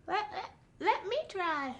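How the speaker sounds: very high, soft small-animal voice; tiny little voice. Acted. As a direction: squeak it out like a tiny creature nobody notices. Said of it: "they hear this little voice".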